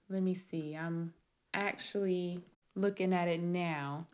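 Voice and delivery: quiet mumble while reading; half under the breath; muttering to herself. Real, not acted. Muttering to herself while checking page.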